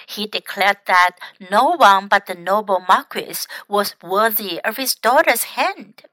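usual speaking voice

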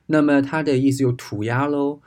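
ordinary talk

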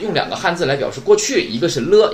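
ordinary delivery

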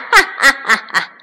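quick, high villain's cackle; rapid-fire triumphant "ha ha"; gloating weasel cackle. A narrator's reading. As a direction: cackle fast at the fools you tricked. Weasel gloats over his trick.

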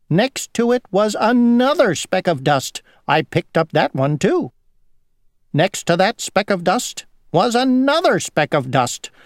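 high, singsong storytelling fly; fussy housefly. A narrator's reading. Housefly to Grasshopper about a day at home, high lilting voice.

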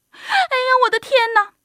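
high, loud startled squeal; old woman crying out. A narrator's reading. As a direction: shriek as a frightened little old lady. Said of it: "瘦小的老妇人喊起来".

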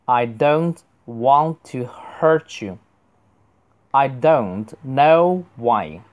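measured, drawn-out phrases for learners; slow model reading. Shown as a demonstration. Teacher models English sentences for students, slow reading. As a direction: read slowly, word by word. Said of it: "我正常读一次".

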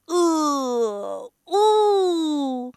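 long drawn-out growling howl; storybook bear roar, rising in pitch. A narrator's reading. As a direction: roar like a bear to scare someone. Bear leaps out roaring to scare.

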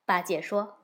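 ordinary delivery, nothing marked

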